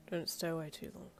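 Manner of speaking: soft, drowsy mumbling; sleepy mumble. A narrator's reading. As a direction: mumble it while dozing off. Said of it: "Darby mumbled".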